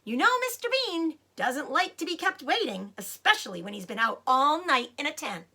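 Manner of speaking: high, loud woman's voice from upstairs; woman upstairs nagging about Mister Bean; woman calling out. Acted. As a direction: keep hollering down, impatient. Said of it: "the voice called".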